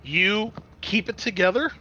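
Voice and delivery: normal talking voice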